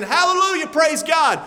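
high happy cry of praise, half shouted; joyful shout. Retold or imitated. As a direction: call out praise like a glad worshipper. Joyful believers quoted in contrast.

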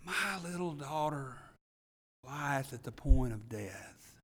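soft, slowed, desperate plea; desperate father. Retold or imitated. Preacher voicing a desperate father begging Jesus, softly despairing. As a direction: let the father's despair hush the words. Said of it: "can't you just hear the desperation in his voice".

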